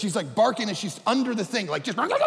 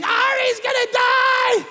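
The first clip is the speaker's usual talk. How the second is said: high, loud, slow wail of panic; screaming; 13 semitones higher, 6 dB louder, much slower than usual. Retold or imitated. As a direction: scream it like a terrified child. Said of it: "just screaming".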